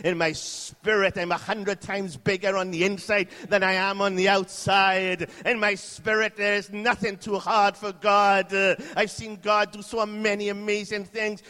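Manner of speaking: loud, high, impassioned testimony; full-force preaching. Real, not acted. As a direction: proclaim it forcefully, as faith overcoming fear.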